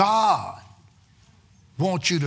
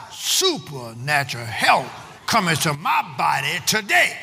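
slow, measured declaration; with conviction; 3 semitones lower than usual. Shown as a demonstration. Preacher models the confession for the congregation, slow and deliberate. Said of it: "Say it with some conviction".

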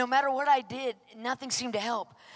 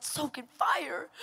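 slow, mock-tearful echo; incredulous whine; 3 semitones higher, slower than usual. Tired believer repeating God's instruction back, whining.

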